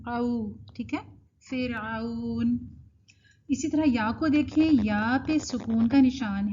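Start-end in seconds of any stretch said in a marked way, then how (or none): 0.1-0.5 او as a gliding "au"; correct leen. Shown as a demonstration. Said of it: "لین کی صفت". 1.5-2.6 فرعون slow, as fir-auun; correct leen. Shown as a demonstration. Teacher repeating the correct word, slow glide. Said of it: "لین کی صفت".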